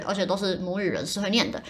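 normal talking voice

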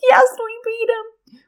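high-pitched mock victory squeal; triumphant cheer. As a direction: reenact a gleeful win, voice way up high. Retelling the family's triumph at winning.

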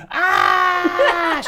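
very high, loud mock shriek; imitated tantrum scream. Father imitating child sent to corner, piercing scream. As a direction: mimic a child's screaming meltdown. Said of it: "goes apeshit, right?".

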